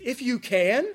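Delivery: high, loud disbelief at the words; incredulous exclamation. Retold or imitated. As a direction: throw the words back in disbelief. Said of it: "there's an exclamation point there".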